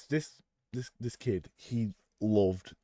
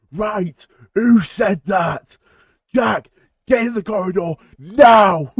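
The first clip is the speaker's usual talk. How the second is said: furious teacher bellowing, much higher and louder; teacher yelling; 11 semitones higher, 17 dB louder, slower than usual. Retold or imitated. Teacher bellowing at Jack to get in the corridor. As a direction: roar at the pupil like an angry teacher.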